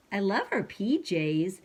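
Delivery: ordinary delivery, nothing marked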